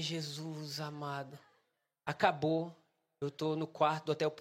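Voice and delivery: low, soft, slow defeat; mock despair. Preacher retelling his failed first sermon, hushed defeated lament. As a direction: sink into a hushed, drawn-out lament.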